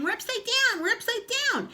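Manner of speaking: very high mock shriek of his wife; mocking her shout. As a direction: parrot her squealing to mock her. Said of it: "hopping around and shouting".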